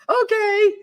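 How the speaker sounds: high, loud mock-timid agreement; octave up. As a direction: agree at once in a scared, high voice. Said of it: "And my voice goes, mm, an octave up".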